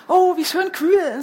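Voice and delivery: slow, sighing with relief; breathy pleased sigh, drawn out; content sigh. A narrator's reading. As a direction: sigh it out as the cool water feels good. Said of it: "seufzte er".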